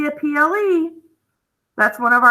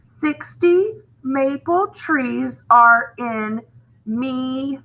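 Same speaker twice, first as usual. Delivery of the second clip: slow, deliberate trial reading of the sentence; slow test read; much slower than usual. Shown as a demonstration. Teacher testing the wrong word by reading slowly to students. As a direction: read it out slowly to test whether it sounds right. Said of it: "read your sentence and see which one sounds right".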